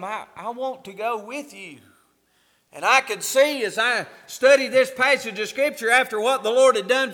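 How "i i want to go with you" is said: hushed, softer plea to go along; quiet pleading. Acted. Healed man begs Jesus to take him along, softly pleading. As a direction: beg softly, almost under the breath.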